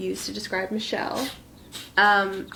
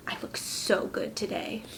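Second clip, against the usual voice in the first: whispered quote; 8 dB quieter than usual. Retold or imitated. Sister quoted admiring herself.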